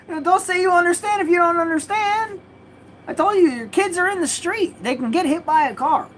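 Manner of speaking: high and strained, nearly cracking; lecturing the neighbor about his kids, furious; exasperated angry rant. Retold or imitated. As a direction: scold him for pretending to understand. Ranting at neighbor, retold.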